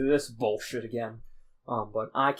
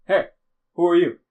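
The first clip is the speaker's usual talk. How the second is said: loud, raised challenge to strangers; calling out; 6 semitones higher, 13 dB louder than usual. Acted. Adventurer surprising two diggers in the cave, calling out loudly. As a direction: shout over to get their attention.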